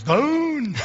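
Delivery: a high, put-on voice for the friend's one-word answer; friend on the phone. Pastor imitating a friend answering his wife's call, high put-on voice. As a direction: squeak it like the friend.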